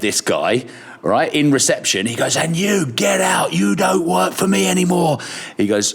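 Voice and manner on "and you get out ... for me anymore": imitating an angry boss, higher and shouted; retold angry firing. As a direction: bark him out of the building, a boss losing it. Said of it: "he got so angry".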